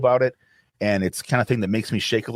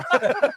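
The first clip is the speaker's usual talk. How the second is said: high burst of laughter. Real, not acted. Laughing at own joke.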